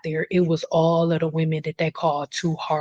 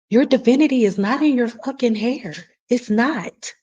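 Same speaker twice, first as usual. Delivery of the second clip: raised, louder and higher, fired up; heated outburst; 6 semitones higher, 3 dB louder than usual. Real, not acted. Ranting to a viewer about hair and divinity, heated and loud. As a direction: push it out hard at the idea.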